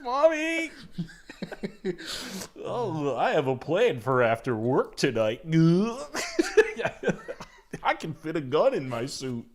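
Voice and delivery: high, laughing cartoon dog plotting; a grim plan in a silly mascot voice; hammed-up Goofy scheming; dark Goofy voice. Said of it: "It's like".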